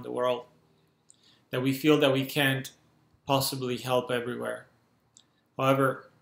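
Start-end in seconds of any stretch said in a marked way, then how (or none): none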